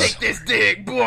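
loud, high jokey shout; joking shout. Real, not acted. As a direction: bark it out for laughs. Crude joke shouted.

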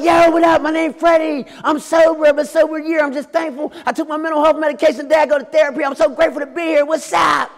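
high, loud, exuberant self-introduction; screaming out on campus. Retold or imitated. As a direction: shout it across campus like an overjoyed newcomer. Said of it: "I was screaming out on campus".

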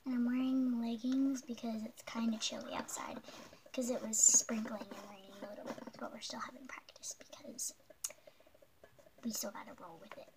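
soft steady whisper. Real, not acted. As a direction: whisper the explanation quietly. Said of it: "we need to be kinda quiet".

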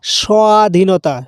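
স্বাধীনতা drawn out slowly, ব glided as wa; slow swa demo. Shown as a demonstration. Teacher slowly demonstrates স্বাধীনতা to the class, wa glide. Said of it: "সোয়া, ওয়ার মতো উচ্চারণ হচ্ছে".

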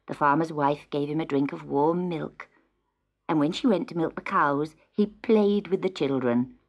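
usual speaking voice

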